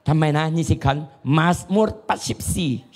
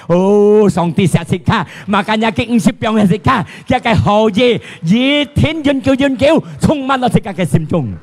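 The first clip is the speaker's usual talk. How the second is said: high, loud proclamation; exultant preaching; 9 semitones higher, 8 dB louder than usual. Acted. Preacher praising God's love to the congregation, exultant.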